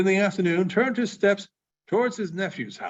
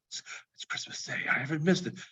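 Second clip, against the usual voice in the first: unvoiced whisper to himself; awed whisper; 8 dB quieter than usual. Scrooge whispering to himself in wonder. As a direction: marvel under your breath.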